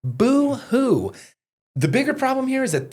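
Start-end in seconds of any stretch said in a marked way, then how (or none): 0.0-1.1 high sneering boo hoo; mock the crybaby. Real, not acted. Mocking cop's hurt feelings.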